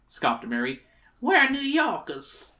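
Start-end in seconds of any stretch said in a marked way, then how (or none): 1.2-2.5 put-on high, slow girlish voice; girl character. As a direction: stretch it out in a high voice. Mary's line, voiced higher.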